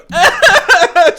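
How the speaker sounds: high, loud belly laugh; big uncontrolled high laugh; loud burst of laughter. Real, not acted. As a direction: crack up loudly at the silly image. GM laughing at imagined scene.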